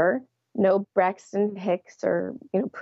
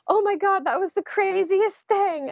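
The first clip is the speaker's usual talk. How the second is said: much higher, breathless amazement; amazed; 11 semitones higher than usual. Retold or imitated. Right after the birth, amazed and giddy. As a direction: marvel at what just happened. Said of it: "I remember saying things like".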